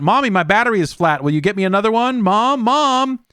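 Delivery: put-on high-pitched childish call for mom; whiny kid shouting. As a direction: shout for mommy like a little kid. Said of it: "shouting out".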